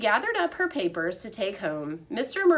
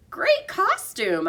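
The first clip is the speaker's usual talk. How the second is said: high, louder little squeak; squeaky thanks; 9 semitones higher, 5 dB louder, slower than usual. A narrator's reading. Hamster Humphrey thanks the cheering class in a high squeak. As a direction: squeak it out with delight. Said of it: "I squeaked".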